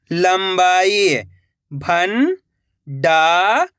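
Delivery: first part slow and drawn out, then the whole word said plainly; patient teacher reading words for children; sound-out reading drill. Shown as a demonstration.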